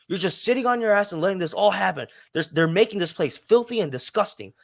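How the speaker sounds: high, indignant mock rant; imitated enraged man. Man accusing park staff of doing nothing, mock enraged. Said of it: "came up to me enraged".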